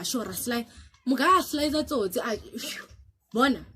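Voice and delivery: normal talking voice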